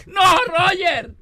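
high, slow, drawn-out shout; mock line judge yell. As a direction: holler the player's name in mock outrage. Acting line judge shouting at Roger. (grito agudo y alargado al jugador)

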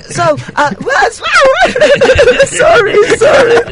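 high, slow laughing mock cry; laughing fake sob. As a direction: crack up while faking tears. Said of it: "yo te ponía a llorar". (llanto falso entre carcajadas)

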